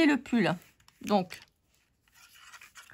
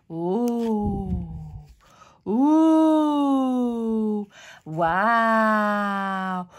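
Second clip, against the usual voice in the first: rising oohs and wows of amazement; hammed-up oohs; gushing wonder; much slower than usual. Wonder at pop-up effect.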